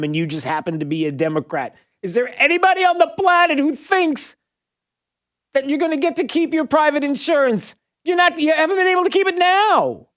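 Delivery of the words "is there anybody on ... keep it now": high-pitched disbelief at listeners; exasperated, squeaky-high incredulity; incredulous outburst. Real, not acted. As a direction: pitch it way up, challenging anyone to believe it. Host's incredulous rant at Democratic listeners.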